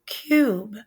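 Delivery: "cube" with a clear yoo glide. Shown as a demonstration. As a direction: bring out the y-oo in "cube". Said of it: "you have the Y O O as in".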